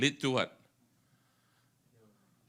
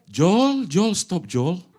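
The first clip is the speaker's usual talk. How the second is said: calling Joel; 6 semitones higher, 7 dB louder, much slower than usual. Acted. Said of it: "the Holy Spirit whisper in your ear".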